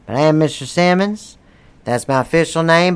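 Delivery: ordinary talk, nothing marked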